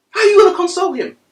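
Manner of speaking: high, loud and fast; fast shouted question. Real, not acted. Preacher challenges the congregation, shouting fast and high. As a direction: fire the question at the crowd. Peak of the sermon.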